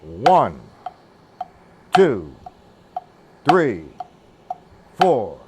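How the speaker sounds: loud, widely spaced single numbers; counting the beats. Shown as a demonstration. Drum teacher doing the same thing with the left hand, loud spaced counting. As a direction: punch out each count on the accent.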